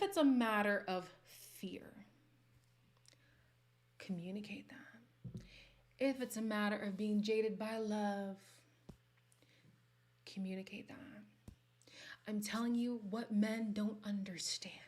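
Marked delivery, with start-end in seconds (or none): 4.0-5.4 soft pointed whisper; hushed punchline. Real, not acted. Whispered advice after a setup. 10.3-11.0 soft pointed whisper; hushed punchline. Real, not acted. Repeated whispered advice.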